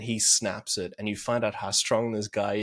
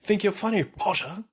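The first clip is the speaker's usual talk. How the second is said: sneering schoolboy taunt, hard aspirated P on "Potter"; mock Malfoy taunting his rival; 9 semitones higher than usual. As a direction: spit out "Potter" with a punched P like a smug bully. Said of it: "the P on Potter for me when Malfoy says that".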